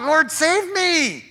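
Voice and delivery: high, loud, slow cry for help; yelled plea. Acted. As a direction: yell it out like you're drowning. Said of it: "he yelled out".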